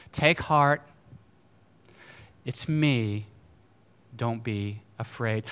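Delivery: soft, low, unhurried comfort; calming reassurance. Retold or imitated. Jesus comforting frightened disciples at sea, calming reassurance. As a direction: soothe them quietly, pausing between phrases. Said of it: "He gives them a little word of peace".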